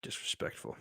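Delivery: ordinary talk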